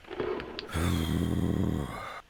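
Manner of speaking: long, low, soft exhale; weary sigh. Real, not acted. Viewer lost in a confusing film, weary sigh. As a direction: let out a long baffled sigh.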